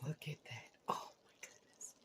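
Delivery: breathy awed whisper. Real, not acted. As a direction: whisper it in awe. Admiring the finished dish.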